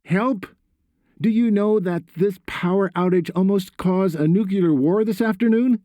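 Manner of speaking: high-pitched outraged outburst; exploding in anger. A narrator's reading. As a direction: explode at your son, pitch shooting up. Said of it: "Tony exploded".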